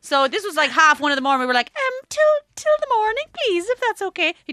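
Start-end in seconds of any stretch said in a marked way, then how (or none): 1.8-4.3 much higher, meek asking; timid polite voice. Retold or imitated. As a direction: ask nervously, very politely. Tourists politely asking for room.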